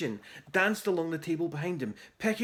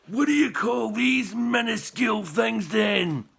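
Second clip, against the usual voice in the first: scornful shout; 5 semitones higher, 5 dB louder than usual. A narrator's reading. Angry chief mocks tiny sandwiches.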